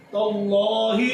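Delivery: "اللہ" recited heavy, as "lloh"; thick lam. Shown as a demonstration. Teacher demonstrating lam after zabar, thick lam. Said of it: "اس کو موٹا پڑھیں گے".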